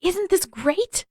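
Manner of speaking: high, slow and overloud; exuberant. Acted. Boy showing off to his friend, exuberant. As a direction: stretch it out in delight. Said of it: "speaking louder than he needed to in his exuberance".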